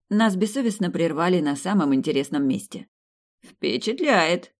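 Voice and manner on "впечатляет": slow, thoughtful praise. Acted. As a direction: draw it out while pondering. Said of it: "кивнул задумчиво Васька".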